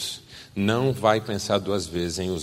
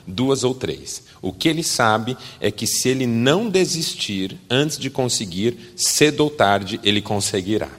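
normal talking voice

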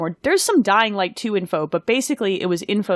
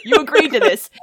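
laughing; 9 semitones higher, 6 dB louder than usual. Real, not acted. Teasing a co-host, laughing.

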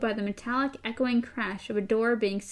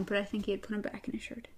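whispered; 9 dB quieter, faster than usual. Real, not acted. Aside while reading.

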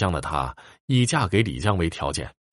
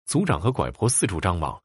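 plain reading voice

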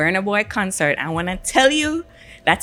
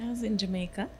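very soft, slightly raised voice; near whisper; 3 semitones higher, 11 dB quieter, faster than usual. Real, not acted. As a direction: say it under your breath, reluctant to announce it. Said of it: "don't you whisper it like that".

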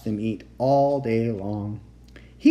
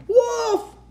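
high, loud alarm yell; crying out; 6 dB louder than usual. A narrator's reading. Shepherd boy fleeing the real wolf, high crying out.

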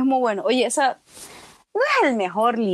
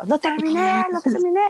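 high-pitched mock boasting; orgulloso retold; 10 semitones higher than usual. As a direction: imitate a brother proudly announcing his feat. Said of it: "lo terminó todo orgulloso". (imitando con voz aguda a alguien orgulloso)

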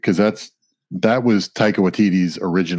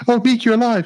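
squeaky high delight; high excited voice; 14 semitones higher, 3 dB louder than usual. Retold or imitated. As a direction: pitch way up in surprise. High excited Korg quote.

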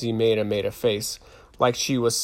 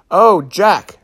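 mock swooning; 7 semitones higher, 9 dB louder than usual. Mock swooning while kissing own wrist.

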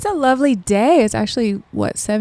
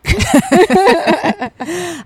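high mocking drawl; 8 semitones higher, 4 dB louder than usual. Real, not acted. Mocking reply.

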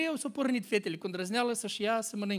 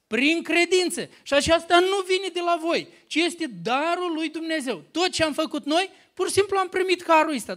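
raised, emphatic preaching voice; 5 semitones higher, 6 dB louder than usual. Acted. Preacher proclaiming grace to the church, emphatic and raised. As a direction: proclaim grace to the congregation with force.

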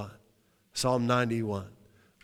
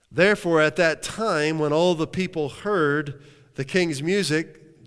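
rising, louder preacher's call; preaching; 3 semitones higher, 4 dB louder than usual. Acted. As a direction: lift the congregation with it. Preaching at full force.

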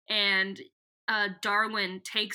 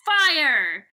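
loud, higher mock battle order; commanding shout; 4 semitones higher, 9 dB louder than usual. Voicing Visser One ordering her troops, mock shouted command. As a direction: yell the order. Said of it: "she's like".

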